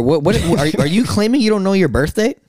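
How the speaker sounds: incredulous. Real, not acted. Disbelief at not knowing birthday.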